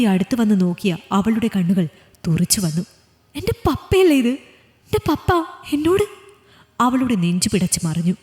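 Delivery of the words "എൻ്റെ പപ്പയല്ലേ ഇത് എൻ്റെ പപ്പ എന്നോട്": high, stunned cry of recognition; shocked disbelief. Acted. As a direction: gasp it out, not believing it. She recognizes her attacker.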